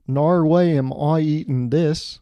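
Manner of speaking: loud, drawn-out mock Australian outburst; Australian accent. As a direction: refuse loudly in a hammed-up Australian accent. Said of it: "But she's also Australian".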